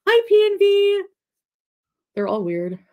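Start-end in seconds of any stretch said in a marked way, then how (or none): 0.1-1.0 high, loud singsong hello; squeaky high greeting. Acted. Greeting a viewer in a silly voice.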